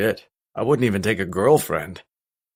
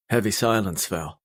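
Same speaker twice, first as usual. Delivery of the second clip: ordinary delivery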